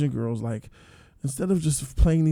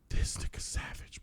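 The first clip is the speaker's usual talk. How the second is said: stunned whisper; 12 dB quieter than usual. Retold or imitated. A viewer reacting to 218's betrayal, whispered. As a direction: breathe it out in shock.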